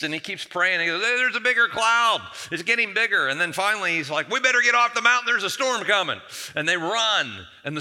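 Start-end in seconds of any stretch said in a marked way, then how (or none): 1.0-3.3 high, loud mock alarm, calling out news; excited report. Assistant reporting a growing cloud. 4.3-6.2 high, loud acted alarm; urgent warning. Assistant warning of a coming storm.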